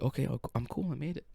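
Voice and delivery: very soft, relieved mutter; self-calming. Retold or imitated. Rider just off the ride steadies himself, quiet self-calming. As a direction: reassure yourself under your breath. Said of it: "And I'm like".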